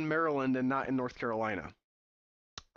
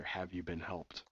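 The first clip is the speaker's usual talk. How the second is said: puzzled re-read; 7 semitones lower, 9 dB quieter, slower than usual. Retold or imitated. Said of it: "That's some weird phraseology".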